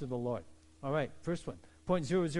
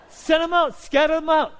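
much higher and louder, near a shout; shouted exhortation; 11 semitones higher, 11 dB louder than usual. Acted. Preacher shouting at the congregation to go out, full force. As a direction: blast it to the back row.

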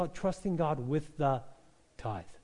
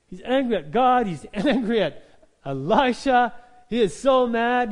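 louder, rising stress on angry and mad; emphatic build; 4 semitones higher, 7 dB louder than usual. Acted. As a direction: build the anger up with force. Preacher building Naaman's anger.

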